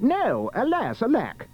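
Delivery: high, melodramatic prince; anguished cry. Acted. Prince losing Cinderella at midnight, crying out. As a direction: cry out like a thwarted lover. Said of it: "The prince cried".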